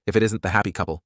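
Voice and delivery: plain reading voice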